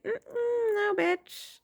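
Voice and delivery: high singsong mock "no"; mocking refusal. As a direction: imitate a sassy, teasing refusal. Voicing forces refusing to let them.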